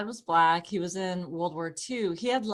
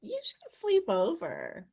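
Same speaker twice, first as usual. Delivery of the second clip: put-on girlish invite; 7 semitones higher than usual. A straight friend invites her over, lightly high and sweet. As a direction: coo the sleepover offer. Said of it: "you get girls going like".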